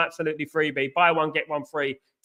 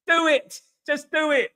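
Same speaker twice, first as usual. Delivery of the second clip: gleeful egging on; 9 semitones higher than usual. Real, not acted. Eggs on the wind-up, laughing after.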